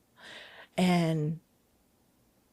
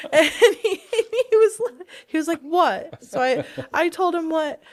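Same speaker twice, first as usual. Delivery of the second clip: laughing; 9 semitones higher, 5 dB louder than usual. Real, not acted. Laughing while retelling to Ben.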